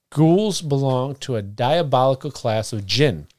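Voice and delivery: ordinary talk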